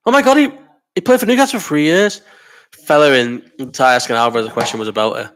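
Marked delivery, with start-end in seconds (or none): 0.1-2.2 shocked. Real, not acted. Shocked discovery.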